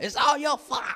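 loud, high accusing yell; angry mock shout. Household blames him for calling the storm over, angry mock shout. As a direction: imitate an angry household blaming you. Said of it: "Everybody got mad at me".

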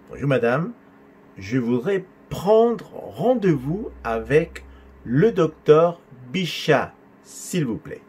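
drawn out, pausing between word groups. Shown as a demonstration. Teacher repeating caller's line for student, slow and segmented. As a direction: spell out the request chunk by chunk, slowly.

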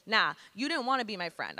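ordinary talk, nothing marked

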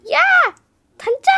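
high, loud excited calls to a best friend; best friend calling out across the hall, excited yell. Retold or imitated. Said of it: "하면서 막 와요".

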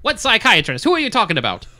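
loud, high newsreel announcer; old newsreel narrator. Acted. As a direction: demand answers in newsreel style. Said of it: "I lost my newsreel voice".

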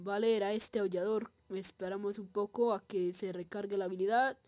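ordinary talk, nothing marked

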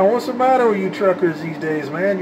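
normal talking voice

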